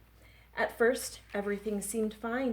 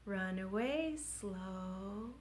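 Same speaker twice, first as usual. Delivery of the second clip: very slow, softer; 7 dB quieter, much slower than usual. Shown as a demonstration. Children's rhyme, slow line sung drawn-out. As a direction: drag it out.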